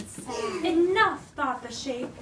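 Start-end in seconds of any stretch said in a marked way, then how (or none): 0.6-1.2 louder, higher fed-up cry; exasperated outburst. Acted. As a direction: burst out with a tired enough. Shape fed up.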